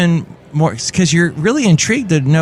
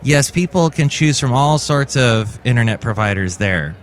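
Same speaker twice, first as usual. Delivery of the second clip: ordinary talk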